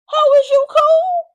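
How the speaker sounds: very high, loud, drawn-out cry; exaggerated high-pitched outburst; outraged squawk. Reaction to being hit.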